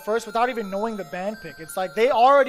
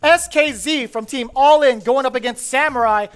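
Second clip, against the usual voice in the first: raised, punchy send-off to the game; match announcer; 3 semitones higher, 5 dB louder than usual. Real, not acted. Caster inviting viewers to sit back and enjoy, announcer. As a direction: sell the match-up to the crowd.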